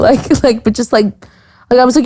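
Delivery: ordinary talk, nothing marked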